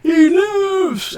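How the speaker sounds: high, drawn-out cheer; elated high holler, stretched out; crowd shout. Acted. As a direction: call it out high like an amazed spectator. Fighters call out he lives.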